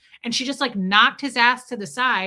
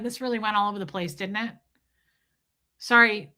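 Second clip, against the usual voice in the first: ordinary talk, nothing marked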